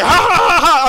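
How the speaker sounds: loud, high, hammed-up cackle; big mocking ha ha ha; mock laughing. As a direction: act out a dad's cruel laugh. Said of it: "spanking her while laughing at her".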